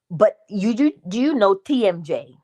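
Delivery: usual speaking voice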